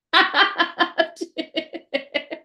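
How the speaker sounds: high burst of real laughter. Real, not acted. Tarot reader cracking up at a viewer's funny name, real laugh.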